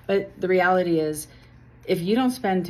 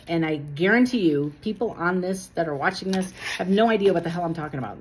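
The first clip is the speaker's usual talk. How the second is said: ordinary delivery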